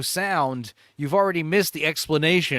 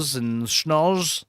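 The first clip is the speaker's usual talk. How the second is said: fake Russian accent; much slower than usual. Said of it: "what does that even sound like".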